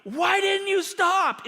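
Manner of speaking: high, drawn-out shout of a yelling cop; angry yell. Retold or imitated. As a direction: imitate an officer shouting at a driver. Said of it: "he starts yelling at me".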